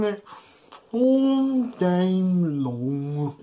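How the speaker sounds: slow, drawn-out cow chewing; slow chewing demo. Shown as a demonstration. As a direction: show the kids how to chew slowly. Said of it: "Can you all chew like a cow?".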